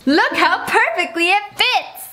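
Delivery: excited. Real, not acted. Excited at the perfect fit.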